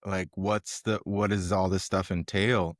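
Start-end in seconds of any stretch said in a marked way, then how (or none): none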